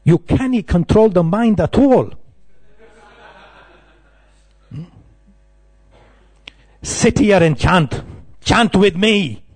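put-on high, scolding Scottish accent. Scottish monk scolds new devotee, Scottish accent. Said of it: "very good at Scottish accent".

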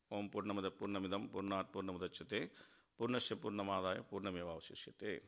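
soft, low chanted Sanskrit recitation; mantra recitation. Acted. Speaker reciting the Ishopanishad invocation to listeners, soft chant. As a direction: intone the verse reverently.

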